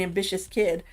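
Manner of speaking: ordinary talk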